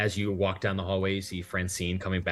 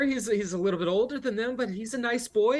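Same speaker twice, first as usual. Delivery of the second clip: higher, fluttery and eager to help; eager hostess; 14 semitones higher, 4 dB louder than usual. Acted. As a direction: hurry to be helpful.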